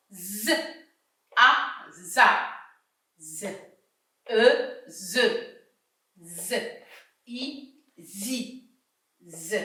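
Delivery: each letter sounded out, then blended into one syllable; syllable drill. Shown as a demonstration. Teacher sounding out syllables for first-year pupils, slow drill. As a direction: spell Z and a vowel slowly, then join them. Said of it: "Je commence".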